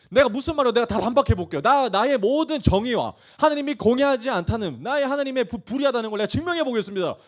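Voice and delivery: high, loud, defiant declaration; confident Job. Acted. Job vowing to prove his case to God, confident. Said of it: "아무리 이렇게 딱 당당하게".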